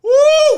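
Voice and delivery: sudden high loud whoop. Retold or imitated. Comic telling how the windmill went, a high whoop. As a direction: let out a squealing woo.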